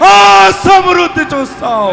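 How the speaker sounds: preacher proclaiming abundance to the crowd, shouting; preaching at full force. Acted. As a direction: shout the victory to the hall. Climactic proclamation continues.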